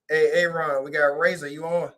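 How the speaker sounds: ordinary talk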